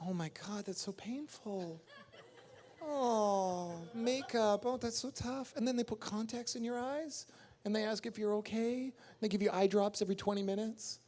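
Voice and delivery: high, whiny mock complaint about makeup; mocking whiny actor. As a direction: play a spoiled actor moaning, high-pitched. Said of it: "they sit there and complain about this shit".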